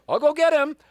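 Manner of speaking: high eager reply; eager squeak. Retold or imitated.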